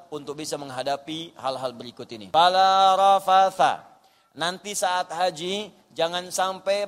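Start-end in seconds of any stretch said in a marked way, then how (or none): 2.3-3.9 loud, very slow recited phrase; Quran recitation. Real, not acted. Preacher warning pilgrims with scripture, loud slow recitation. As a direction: stretch each syllable, projected strongly.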